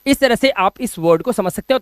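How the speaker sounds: normal talking voice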